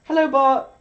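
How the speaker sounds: loud greeting. Real, not acted.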